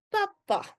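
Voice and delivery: "papa" high, in two short syllables; demonstrated pronunciation. Shown as a demonstration. Teacher shows how double-P "papa" is said, short and high. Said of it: "when you say it, we say".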